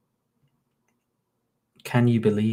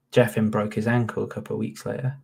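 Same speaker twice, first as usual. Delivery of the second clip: ordinary talk, nothing marked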